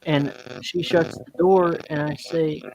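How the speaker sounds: usual speaking voice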